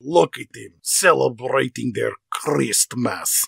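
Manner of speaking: put-on slow, menacing Russian-accented villain; Rostov, Russian accent. Villain watching Americans celebrate Christmas, slow Russian accent. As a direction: savour each word slowly in a Russian accent. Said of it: "and he says".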